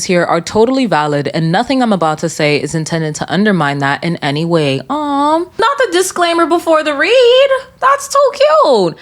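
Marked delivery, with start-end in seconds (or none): 4.9-9.0 much higher, sing-song cooing; mock cooing. Real, not acted. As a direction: coo at it as if it were adorable. Mocking the polite disclaimer.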